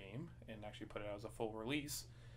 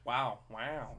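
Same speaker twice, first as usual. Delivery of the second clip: Owen Wilson wow. Retold or imitated. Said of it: "You do the Owen Wilson".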